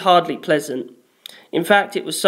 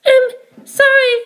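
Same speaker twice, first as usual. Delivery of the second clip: put-on much higher, light girl's voice; shy, apologetic girl; Penelope's voice; 6 dB louder than usual. A narrator's reading.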